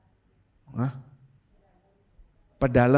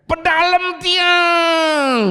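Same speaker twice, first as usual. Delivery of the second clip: high, loud, very drawn-out scream; shouted plea; 5 dB louder, much slower than usual. Shown as a demonstration. Preacher shows how the woman would plead in Balinese, shouting. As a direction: yell the Balinese plea at full stretch. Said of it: "pasti teriak".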